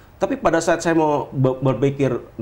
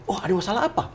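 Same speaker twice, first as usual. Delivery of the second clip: imitating creditor's question; 8 semitones higher, 4 dB quieter than usual.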